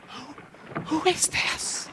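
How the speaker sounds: hushed, breathless, scared whisper; frightened whisper. Acted. Disciples whispering in fear on the still sea. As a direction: whisper it as if afraid to be heard.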